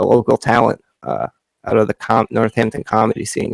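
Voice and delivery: ordinary talk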